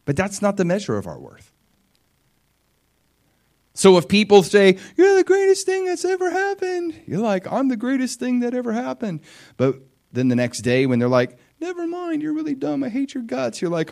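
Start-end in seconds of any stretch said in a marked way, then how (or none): 5.0-6.9 very high, loud mock praise; put-on squeal of adoration; gushing flatterer. 7.5-9.2 high-pitched, puffed-up echo of praise; put-on high, elated voice; mock self-delight. Acting out someone believing the flattery. 11.6-13.6 very high put-on voice; catty insult in a high fake voice; mock fickle critic. Acting out fickle people turning mean.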